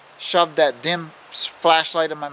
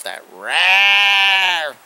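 loud high roar, louder and higher; imitated roar; 6 semitones higher, 6 dB louder than usual. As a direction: roar it out like the beast. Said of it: "this sound was loud".

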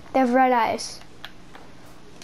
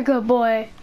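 normal talking voice

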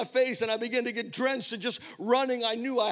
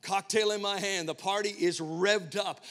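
ordinary talk

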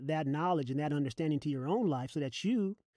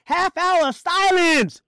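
slow, high, loud shout; ringing preacher's command; shouted proclamation; 12 semitones higher, 12 dB louder, much slower than usual. Acted. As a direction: holler it out, stretching SILENCE. Shouted repeat.

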